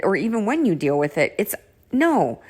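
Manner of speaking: normal talking voice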